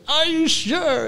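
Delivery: high, slow pleading little voice; Facebook app. Retold or imitated. Phone app questioning its deletion, pleading. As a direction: draw it out in a put-on squeak. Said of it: "and it said".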